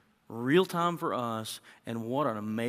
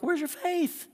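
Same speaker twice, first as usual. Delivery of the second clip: sharp, much higher challenge; Jesus asking; 4 dB louder, slower than usual. Acted. Jesus challenging his frightened disciples, high and sharp. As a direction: fling the question up high.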